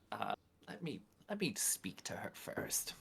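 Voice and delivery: hushed unvoiced whisper to the group. Whispering to the party before knocking. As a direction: whisper it so the host can't hear.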